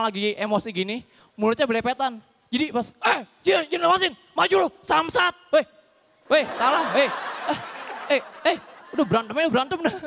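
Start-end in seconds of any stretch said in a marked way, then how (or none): 2.9-9.9 hammed-up brawler shouting; mock fighter yelling. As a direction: rant like a hothead being separated. Mouthy fighter held back.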